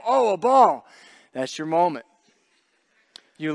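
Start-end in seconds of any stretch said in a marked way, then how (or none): 0.0-0.9 sudden high, loud mock-innocent voice; bright mock surprise at a ball; kids spotting the ball. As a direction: play the oblivious kid who just noticed a ball. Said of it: "they go".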